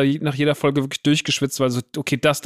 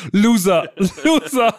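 höher und lauter, lachend gerufen; spöttischer Loser-Ruf; 7 semitones higher, 4 dB louder, slower than usual. Retold or imitated. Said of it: "der zweite Loser ist so ein bisschen zu kurz". (spöttisch gerufenes Loser)

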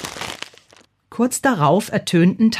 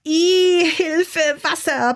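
high-pitched wailing cry for help; bawling; 10 semitones higher, much slower than usual. A narrator's reading. Soaked gargoyle cries for help, bawling in high pitch. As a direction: bawl it out, drawn out and very high. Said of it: "plärrte der".

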